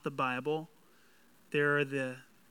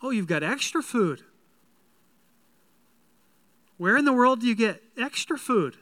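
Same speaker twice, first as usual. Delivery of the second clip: astonished; 5 semitones higher, 5 dB louder, faster than usual. Acted. Jesus' family voiced, astonished.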